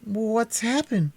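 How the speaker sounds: high, slow, sheepish ask; puzzled boy. A narrator's reading. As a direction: ask slowly, bewildered. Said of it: "a little ashamed".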